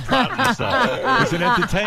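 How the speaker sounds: laughing hard while getting the words out. Real, not acted. Guest cracking up at the president's improvised mic drop, laughing.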